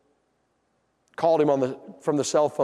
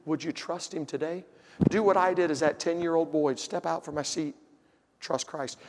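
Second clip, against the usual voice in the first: softer, gentle invitation; soft altar call; 4 dB quieter than usual. Real, not acted. Preacher inviting listeners to trust Christ, soft and tender. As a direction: plead gently with them.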